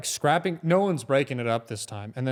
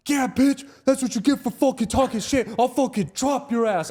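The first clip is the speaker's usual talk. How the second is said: high, loud retold trash talk; barking; 11 semitones higher, 4 dB louder than usual. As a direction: bark it at a beaten rival. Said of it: "I'm, like, barking at him".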